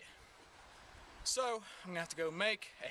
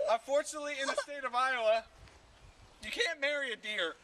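manic confession; 8 semitones higher, 5 dB louder than usual. Acted. Unhinged rant about deer.

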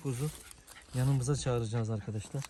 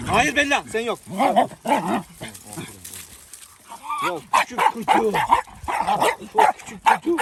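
talking to a dog; 8 semitones higher, 9 dB louder than usual. Real, not acted. Talking to the dogs.

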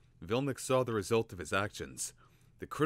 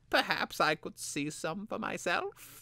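high, slow, hesitant apprentice; tentative young apprentice; 11 semitones higher than usual. Acted. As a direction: ask carefully, pitched up. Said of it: "he suggested tentatively".

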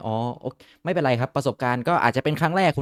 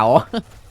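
loud rising laugh; burst of laughter; 3 semitones higher, 6 dB louder than usual. Real, not acted. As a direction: laugh out at the win. Laughing after burning enemies.

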